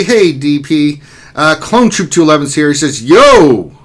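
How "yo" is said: loud, punchy mock yo; strong yo. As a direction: belt out the greeting. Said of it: "That's a strong yo".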